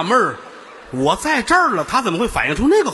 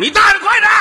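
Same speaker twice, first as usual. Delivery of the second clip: 越说越高，最后尖声大吼; 学耳麦里的人狂喊; 学电脑里的喊叫; 9 dB louder than usual. Retold or imitated. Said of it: "他这". (从喊到尖声大吼)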